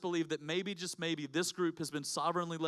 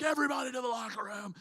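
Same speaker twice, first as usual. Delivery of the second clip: high, strained mock yell held back in volume; mock order hollered across a gym; imitated coach's holler; 7 semitones higher than usual. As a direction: call out like a berserk coach. Coach going berserk, sends team out.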